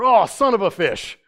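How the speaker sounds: loud high "Aw, fish!" exclamation; mock curse. Friend at Bible college swearing substitute, loud and high. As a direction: blurt it like a swear word.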